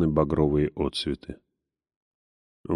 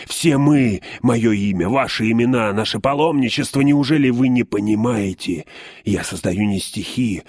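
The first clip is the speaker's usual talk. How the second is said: frenzied; 5 semitones higher than usual. A narrator's reading. Poet raving, waving his poems.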